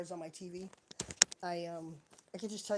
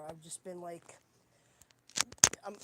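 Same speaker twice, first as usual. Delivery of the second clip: ordinary talk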